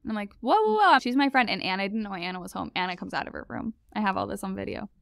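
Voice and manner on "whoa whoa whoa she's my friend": alarmed whoa. Retold or imitated. Roommate kicking out her friend.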